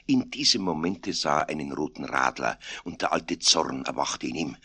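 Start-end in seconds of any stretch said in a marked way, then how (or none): none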